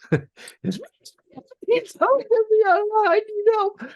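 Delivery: a cool pilot drawl that comes out as a squeak; voice up three octaves. Retold or imitated. Downed pilot radioing his wingman, a squeaky voice cracking upward. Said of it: "my voice was up, like, three octaves".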